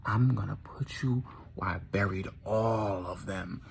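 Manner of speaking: menacing whisper. Acted. Tucker threatens to bury Mandy, menacing whisper. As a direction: whisper it as a deadly promise.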